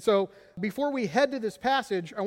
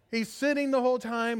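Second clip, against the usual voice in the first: ordinary delivery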